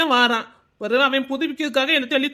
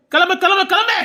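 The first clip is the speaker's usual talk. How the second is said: higher, impatient get-out; shooing her out; 6 semitones higher than usual. Acted. Shopkeeper chasing the woman out, impatient shooing. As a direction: push her out the gate.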